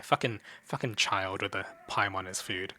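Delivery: ordinary talk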